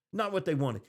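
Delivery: normal talking voice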